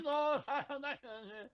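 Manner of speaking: high-pitched mock Indian operator; Indian accent. Co-host answering a customer service call, Indian accent. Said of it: "you put on an Indian accent".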